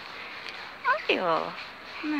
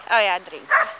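ordinary talk

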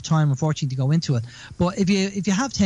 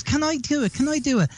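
put-on eager child; 9 semitones higher than usual. Child begging in retold story.

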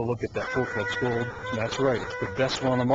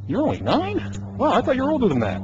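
surprised; 12 semitones higher, 4 dB louder than usual. Real, not acted. Adult amazed at kid's age.

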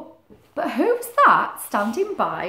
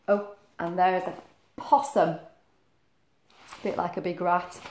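plain reading voice

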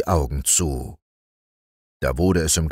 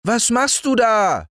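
put-on high little boy's voice, calling upward; child calling up; 3 dB louder than usual. Little Häwelmann calling up to the rooster, high child voice. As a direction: call up to the rooster like an eager small boy. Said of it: "rief der kleine Häwelmann hinauf".

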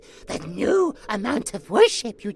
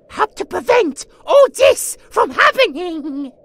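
fast, loud urgent warning; 6 semitones higher, 8 dB louder, faster than usual. Acted. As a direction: rush it out, pressing.

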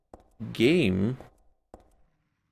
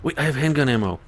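fast, higher and louder; excited realization; 5 semitones higher, 4 dB louder, faster than usual. Real, not acted. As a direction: blurt it out in surprise. Sudden discovery.

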